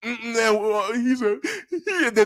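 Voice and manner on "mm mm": ordinary talk, nothing marked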